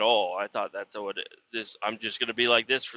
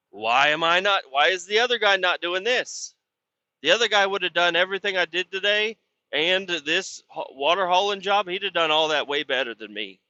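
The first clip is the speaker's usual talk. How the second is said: a worked-up plea for the out-of-town job; retold frustrated plea; 5 semitones higher than usual. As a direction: complain hard at the boss. Pleading rant at the boss.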